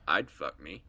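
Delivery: low, creepy killer impression; creepy manly voice. Co-host quoting the film's killer getting ready, creepy manly voice. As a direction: drop it low, imitating a creepy manly man. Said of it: "creepy, manly voice".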